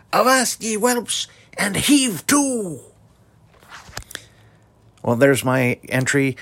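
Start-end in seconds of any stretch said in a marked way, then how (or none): 0.1-2.8 high, loud pirate bellow; pirate captain. Acted. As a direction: roar it like a salty sea dog. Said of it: "Captain Nasty Nate was a salty sea dog".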